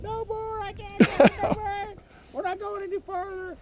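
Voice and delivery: high-pitched mock scream of a panicked lady; high, hammed-up panicky wail; retold panicked screaming. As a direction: imitate a woman crying out that she's done. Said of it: "The lady screaming".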